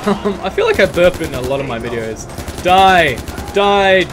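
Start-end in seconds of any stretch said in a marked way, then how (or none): 2.6-4.0 loud, aggressive shouting; loud battle cry. Real, not acted. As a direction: yell it at the enemies. Shooting enemies in game.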